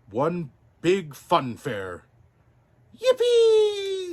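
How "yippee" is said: very high, loud joyful squeal; a gleeful squealed yippee. Acted. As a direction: squeal with delight. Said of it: "squealed Pinocchio".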